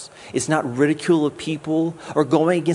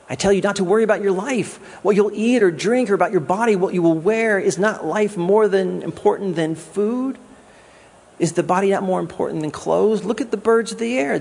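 raised, quicker preaching of the verse, pressing the point home; fervent scripture reading; 5 semitones higher than usual. Acted. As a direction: proclaim it like a preacher building to a peak. Reads scripture with rising fervor.

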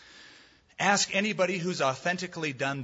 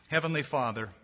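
prayer; 4 semitones lower than usual. Acted. Closing prayer over soft music.

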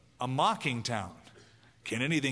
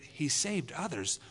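softer, questioning murmur to oneself; puzzled wondering; 3 dB quieter than usual. Retold or imitated. Thief at the cross wondering about Jesus, quiet puzzled question. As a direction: turn it over quietly in your mind. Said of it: "And they would think".

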